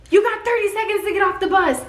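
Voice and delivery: fast, high barked order; drill sergeant. Retold or imitated. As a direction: rush them like a drill sergeant. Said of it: "they say".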